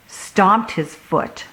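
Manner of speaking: ordinary delivery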